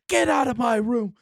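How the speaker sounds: high, loud yell to get out; furious shout. Acted. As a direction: scream them out of the room. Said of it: "and he says".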